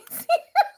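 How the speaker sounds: high giggle. Real, not acted. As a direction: burst of high-pitched giggling. Laughing fit mid-reading.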